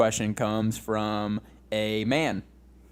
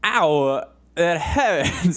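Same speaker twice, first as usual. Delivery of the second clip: halting, loud celebrity impression of pain; Christopher Walken; 5 semitones higher, 4 dB louder, much slower than usual. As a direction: yelp it out higher, in a hammed-up celebrity voice. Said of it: "Christopher Walken, but he's getting his eyes gouged out".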